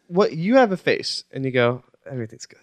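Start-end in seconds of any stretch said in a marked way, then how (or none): 2.1-2.6 much softer, backed off the mic; imitated evasive mumble. Host imitating co-host dodging the question, very soft. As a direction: say it quietly, leaning away from the mic. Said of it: "lean back from the mic a little bit".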